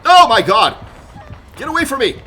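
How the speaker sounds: real high, loud game panic; loud, high cries of alarm; panicked shouting. Real, not acted. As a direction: yell as if being chased down. Being chased in the game.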